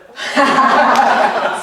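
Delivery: big breathy burst of laughter; loud, knowing belly laugh. Real, not acted. Reacts to question about bets.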